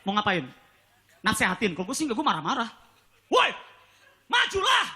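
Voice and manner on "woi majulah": high yelled call out the window; higher-pitched impatient holler at the angkot; mock yell at driver. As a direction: hurry up the angkot, mock angry. Yelling at angkot in traffic.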